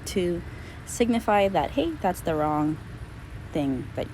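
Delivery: ordinary delivery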